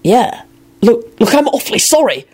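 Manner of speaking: high-pitched, flustered apologising; flustered and desperate to placate; frantic apology. A narrator's reading. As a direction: plead for forgiveness, pitch climbing. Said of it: "Lupin said frantically".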